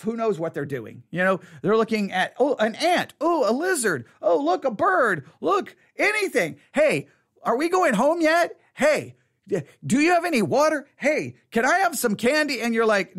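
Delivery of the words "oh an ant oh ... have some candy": distracted kid. Bored kid pestering the parent, high and whiny.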